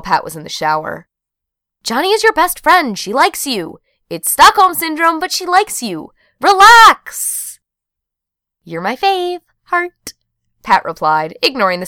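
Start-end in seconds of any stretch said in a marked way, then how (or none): 1.8-7.6 high-pitched, insistent sisterly pep talk; exasperated high-pitched insistence; emphatic reassurance. A narrator's reading. Sister's emphatic reassuring text, acted. 8.6-10.1 very high, cutesy gush; hammed-up high sweet voice; gushing thanks. A narrator's reading. Gushing texted reply to sister.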